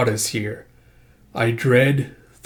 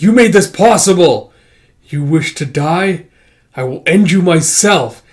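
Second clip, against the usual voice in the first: loud, high accusation easing into menace; accuse, then threaten to kill; furious threat; 5 semitones higher, 7 dB louder than usual. Acted. Panicked character threatening companion who trapped them, angry outburst.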